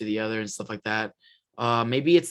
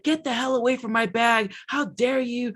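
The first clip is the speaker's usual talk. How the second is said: put-on indignant girlfriend; 8 semitones higher than usual. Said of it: "She's like".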